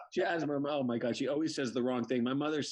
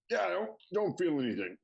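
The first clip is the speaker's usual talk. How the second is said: mock held-breath talk, higher; holding smoke in; 5 semitones higher than usual. Father answers the buddies, imitating holding smoke in. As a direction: imitate speaking without letting the smoke out. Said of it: "He's holding it in".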